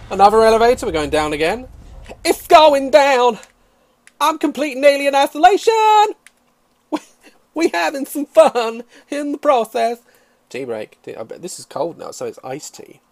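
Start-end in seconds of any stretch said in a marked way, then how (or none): 2.2-10.0 high, loud mock-epic proclamation; playful, over-the-top whooping; hyped-up hollering. Whooping along as elevator descends.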